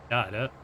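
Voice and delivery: Kjære, low and falling, the home dialect way; Kjære said low with a dropping tone; natural falling accent. Shown as a demonstration. Speaker finally lands the home dialect pitch on the word. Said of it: "it goes down where I'm from".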